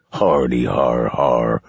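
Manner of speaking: slow, deep villain belly laugh. Acted. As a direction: laugh it from the gut. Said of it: "laughed from deep inside his belly".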